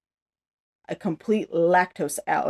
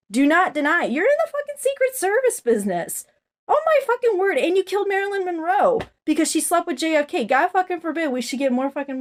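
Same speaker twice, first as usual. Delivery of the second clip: furious rant; 8 semitones higher than usual. Real, not acted.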